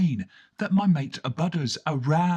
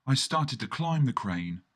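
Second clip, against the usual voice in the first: plain reading voice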